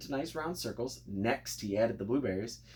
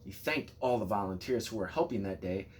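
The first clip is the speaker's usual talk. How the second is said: usual speaking voice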